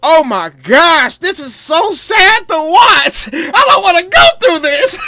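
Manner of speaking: high, loud, slow wailing; hammed-up sob of a fan who can't watch; mock crying. Fan reliving watching the injury, mock wailing. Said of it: "I'm like".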